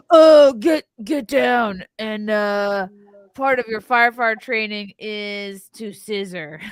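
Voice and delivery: loud, laughing firefighter shout. Pretend. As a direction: yell it like a firefighter through a mask.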